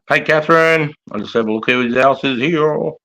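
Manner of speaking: usual speaking voice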